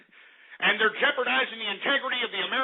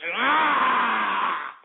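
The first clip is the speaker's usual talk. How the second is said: mock yell; 3 semitones higher, 5 dB louder than usual. Outburst mid-rant.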